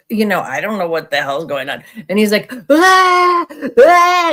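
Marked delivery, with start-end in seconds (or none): 2.7-4.3 high, loud mock wail; imitated hysterical sobbing; imitating hysterical crying. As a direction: ham up a stranger's sobbing cry. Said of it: "he's crying hysterically".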